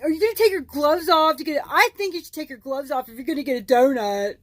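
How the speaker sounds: a snooty, prissy coworker voice; mimicking coworker. Coworker voiced in retold story.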